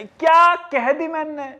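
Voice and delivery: mock-proud, slowly drawn out; smug self-praise. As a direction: savour your own cleverness. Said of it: "कितना genius हूं मैं".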